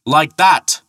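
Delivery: exultant shout over the sound; yell. A narrator's reading. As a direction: Thor shouting as the boulder lands. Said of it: "yelled Thor".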